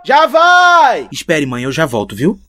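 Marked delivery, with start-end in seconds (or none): 0.0-1.1 high, loud reply; calling from far away. Acted. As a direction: shout back from inside. Son answering from inside.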